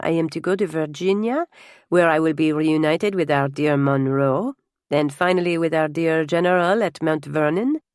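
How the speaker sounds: put-on French-accented traveller laying out his itinerary; genial French nobleman. French guest tells hostess of his travels, genial French accent. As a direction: answer graciously with a French accent.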